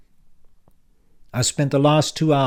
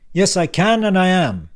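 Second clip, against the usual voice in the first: put-on louder, higher, impatient old man; brusque uncle Frank; 8 semitones higher, 7 dB louder than usual. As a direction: bark it like a testy uncle back from the dead. Frank insists.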